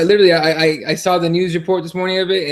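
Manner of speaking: ordinary delivery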